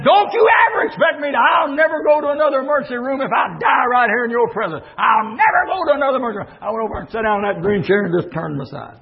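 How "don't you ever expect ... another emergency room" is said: high, loud, fast angry yell at his wife; furious shout. Retold or imitated. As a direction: lose your temper and blast the ultimatum. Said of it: "I mean, I lost it".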